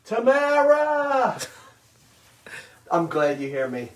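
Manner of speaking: usual speaking voice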